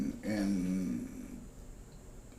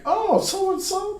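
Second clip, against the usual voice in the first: quoting the paper; 9 dB louder than usual. Retold or imitated.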